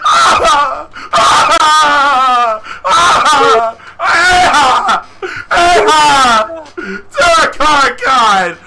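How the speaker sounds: high loud wailing cries; crying scream. Retold or imitated. Getting beaten by three boys, high loud crying screams. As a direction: wail in pain while being kicked. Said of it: "I was legitimately crying".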